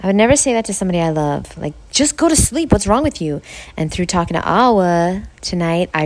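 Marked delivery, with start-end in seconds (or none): 1.9-3.4 impatient scolding, higher pitch; harsh inner critic. Retold or imitated. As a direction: bark the order at yourself, exasperated. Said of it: "that is how I was spoken to as a kid".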